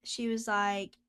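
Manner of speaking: ordinary delivery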